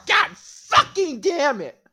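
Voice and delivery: high, loud, drawn-out comic outrage; exasperated shout. Pretend. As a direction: curse the heavens at full volume.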